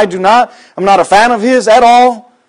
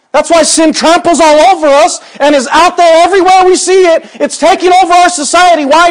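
high, loud, slow hammering of the point; full-force revival sermon, climbing in pitch; fiery preaching; 10 semitones higher, 3 dB louder than usual. Acted. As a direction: preach it hard to the congregation.

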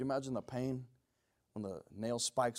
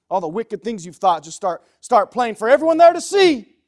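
loud preaching rising to a high yell; fiery escalating pulpit cry; building preacher's shout; 9 semitones higher, 20 dB louder than usual. Real, not acted. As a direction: build to full-force outrage. Preacher builds the judgment scene.

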